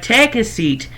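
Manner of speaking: high, put-on hostess invitation; welcoming shopkeeper. Game master voices shopkeeper.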